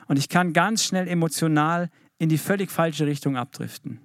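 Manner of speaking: normal talking voice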